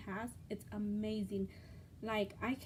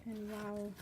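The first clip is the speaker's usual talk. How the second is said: normal talking voice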